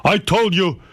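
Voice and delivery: mock stern father laying down the law; imitating father. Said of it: "He goes".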